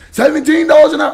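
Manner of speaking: proud chest-out mock brag; said with his chest. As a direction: puff up and boast seventeen dollars an hour. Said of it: "he said it with his chest".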